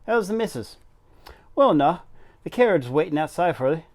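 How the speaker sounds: put-on station master greeting in an amateur Yorkshire accent; attempted Yorkshire accent. Station master welcomes Missus Medlock, tentative Yorkshire accent. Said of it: "spell everything in a Yorkshire accent".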